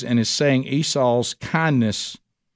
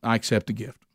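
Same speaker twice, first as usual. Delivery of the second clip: softer, lower and sincere; quiet sincere acceptance; 4 semitones lower, 5 dB quieter than usual. Acted. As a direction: say it gently, from the heart. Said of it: "Esau does what he's been waiting on".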